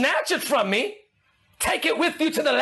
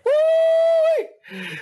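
high, drawn-out holler; whooping from the pulpit; excited whoop; 4 dB louder than usual. Real, not acted. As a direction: let out a long high woo-wee. Preacher whoops after announcing color.

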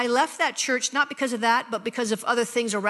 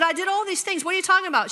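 indignant; 8 semitones higher, faster than usual. Retold or imitated. Arguing with friend at wedding.

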